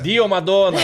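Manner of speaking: loud, hammed-up Italian host voice; Italian accent, loud and theatrical, imitating the host at Veneza; mock Italian exclamation. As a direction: belt it out like an Italian restaurateur. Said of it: "Como é que é esse tom de voz? Me explica".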